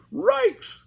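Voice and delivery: very high slow dog-speak; high scared Scooby; Scooby barking. Acted. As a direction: bark in fright. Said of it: "barked Scooby-Doo".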